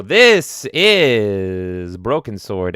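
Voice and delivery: slow, dramatic intro; mock announcer. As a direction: present the game grandly. Introduces game.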